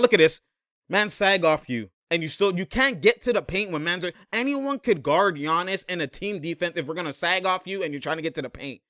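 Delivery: ordinary delivery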